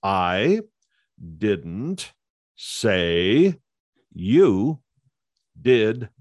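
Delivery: each word set apart by long pauses. Shown as a demonstration. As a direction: say it one word at a time, evenly. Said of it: "Just think of these words".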